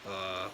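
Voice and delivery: very low, soft "uh"; low held "uh" without lifting at the end; low third tone. Shown as a demonstration. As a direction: drop "uh" to the bottom of the voice, no rise. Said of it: "Just really focusing on going".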